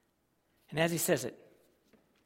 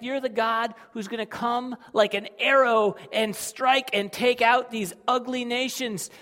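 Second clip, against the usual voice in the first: fervent praise; 7 semitones higher, 9 dB louder than usual. Acted. Voicing the psalmist praising God.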